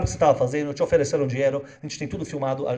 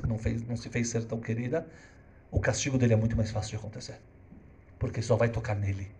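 ordinary delivery

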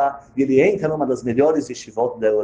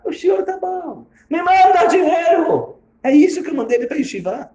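bitter mockery; 13 semitones higher than usual. Father mocking his son's letter, mimicking him high and scornfully. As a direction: parody the son's begging with disgust.

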